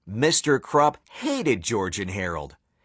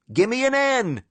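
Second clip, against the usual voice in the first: high cheerleader call; cheer; 10 semitones higher than usual. Acted. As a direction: pump up the crowd. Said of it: "shouted the cheerleaders".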